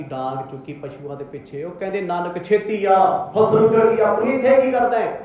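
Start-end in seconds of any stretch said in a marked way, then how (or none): 2.0-5.2 louder, rising call to the son; angry father calling. Acted. Father angrily calling his son to the ruined field. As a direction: summon the boy in a temper. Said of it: "ਬਾਪੂ ਜੀ ਨੂੰ ਤਮਾ ਲੱਗ ਗਈ".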